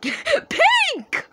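very high laughing cry of a name; gleeful accusing squeal; laughing shout. Real, not acted. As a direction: shriek the name through a laugh. Laughing, calling out player.

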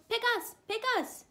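very high, loud pleading cries; excited penguins. A narrator's reading. Penguins begging the mayor to choose them, excitedly. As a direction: beg eagerly like little penguins. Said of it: "jumped up and down excitedly".

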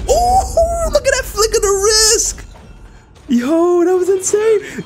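high, thrilled cry of amazement; amazed. Real, not acted. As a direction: marvel at the flick. Amazed at a teammate's flick.

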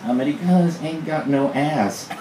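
high-pitched mock complaint; hammed-up grumble from the family patriarch; complaining. A narrator's reading. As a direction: complain with the pitch lifted well up. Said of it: "he complained".

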